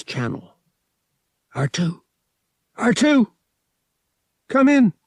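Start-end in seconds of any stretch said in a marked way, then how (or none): 1.5-4.9 much higher, louder call. A narrator's reading. Dazed pilot calling droid over comm. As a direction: call into the comm, rising urgency.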